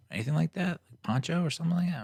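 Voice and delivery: high, quick fussing offer; chatty driver. Retold or imitated. The driver offering a coat, high chirpy fussing.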